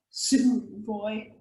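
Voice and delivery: quiet low threat trailing off; snarled threat. A narrator's reading. Fire demon snarling a parting threat at the boy. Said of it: "The fiery man snarled".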